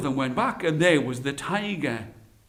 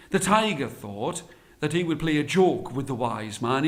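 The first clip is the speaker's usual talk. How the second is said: plain reading voice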